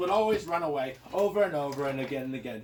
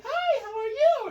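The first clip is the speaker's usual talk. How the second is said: much higher, louder sing-song princess; sweet princess voice; 6 dB louder than usual. Acted. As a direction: chirp it like a dainty princess. Princess greets suitors.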